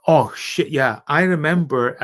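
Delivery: ordinary talk, nothing marked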